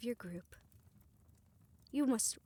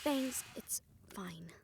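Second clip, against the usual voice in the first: soft slow whisper to calm; soothing; 3 semitones lower, 4 dB quieter, much slower than usual. Acted. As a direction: gently hush a friend. Calming friend softly.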